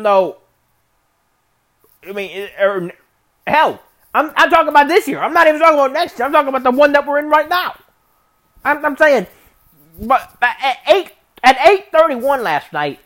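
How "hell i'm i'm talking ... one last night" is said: heated rant. Real, not acted. Ranting about San Antonio.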